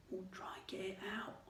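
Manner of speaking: soft unvoiced whisper; boy whispering so the nurse won't hear. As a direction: breathe it so nobody hears. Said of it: "Ron whispered".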